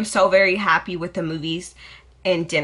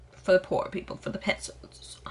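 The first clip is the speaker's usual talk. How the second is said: whispered snob; 9 dB quieter than usual. Pretend. Snobby joke.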